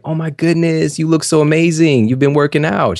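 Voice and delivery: high, gushing praise for a friend; mock gushing, pitched high; gushing compliment. Woman in a skit gushing over her friend, high mock gush. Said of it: "she was like".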